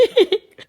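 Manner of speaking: high loud laugh bursting out; burst of laughter. Real, not acted. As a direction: crack up mid-story. Laughs at the memory.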